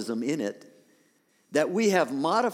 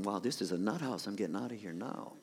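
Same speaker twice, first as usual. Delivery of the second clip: low, hushed mock reaction; imagined aside; 6 semitones lower, 9 dB quieter than usual. Preacher voicing what some of the congregation are thinking, low imagined aside. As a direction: voice an onlooker's thought under your breath, dropping away.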